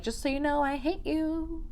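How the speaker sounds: high, soft singsong put-on voice; mock sweet aside. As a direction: say it high and softly, drawing out the end. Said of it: "and be like".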